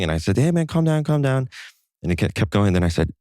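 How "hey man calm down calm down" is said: raised, high-pitched plea; retold plea to settle someone down, pitched up; urging calm. Young guy to yelling stranger at the bar, urging calm. Retelling pleas to a yelling man.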